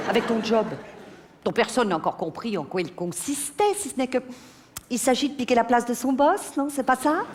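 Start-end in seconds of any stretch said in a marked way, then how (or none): none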